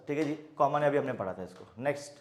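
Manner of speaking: usual speaking voice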